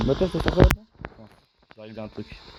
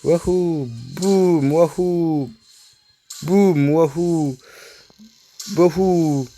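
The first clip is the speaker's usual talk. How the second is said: playful whooping; much slower than usual. Pretend. Whooping along during game action.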